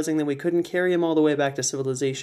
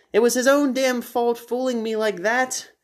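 higher, haughty; Edwin's eccentric voice; 9 semitones higher than usual. Acted. Edwin refusing to leave, haughty. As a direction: dismiss it grandly.

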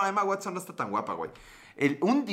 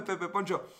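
ordinary talk, nothing marked

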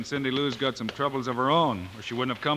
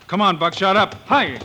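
1 calling out; 5 semitones higher, 6 dB louder than usual. Acted. Urging his horse to ride.

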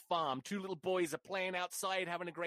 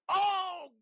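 high, loud drawn-out outburst; exasperated shout; 13 semitones higher, 7 dB louder, slower than usual. Acted. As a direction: yell it out in hammed-up exasperation. Hammed exasperation ending the anecdote.

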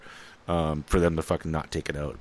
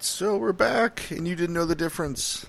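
mock announcer; 10 semitones higher than usual. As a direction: sing out the comeback like a radio host. Host returns from a pause.

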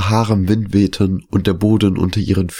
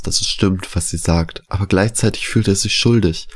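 usual speaking voice

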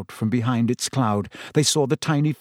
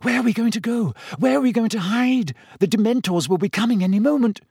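panicked; 10 semitones higher, faster than usual. Acted. Hermione panicking as werewolf comes.

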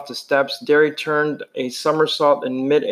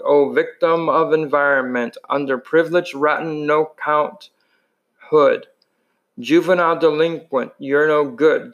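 usual speaking voice

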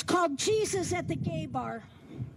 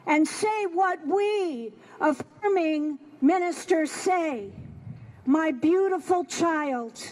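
swelling, louder preacher's poem reading; poem recital; 5 dB louder than usual. Acted. Preacher reading a poem to the congregation, building louder. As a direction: lift the poem like a sermon. Reads poem aloud in sermon style.

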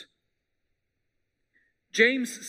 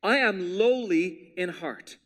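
slow emphasis; slower than usual. Shown as a demonstration. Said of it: "here's this word again".

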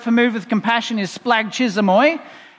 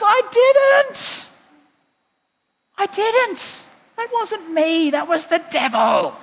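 very high, wailing denial; hammed-up, exasperated protest, far above usual pitch; protesting God; 10 semitones higher, much slower than usual. God answering his people's blame, high-pitched protest. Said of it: "And God goes".

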